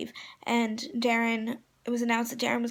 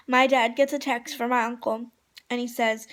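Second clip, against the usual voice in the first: usual speaking voice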